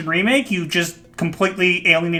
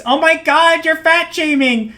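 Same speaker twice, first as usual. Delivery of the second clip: high-pitched mock scandalized critic; mock outrage; 9 semitones higher than usual. Mimics outraged online critics.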